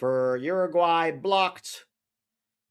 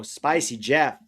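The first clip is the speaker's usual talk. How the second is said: normal talking voice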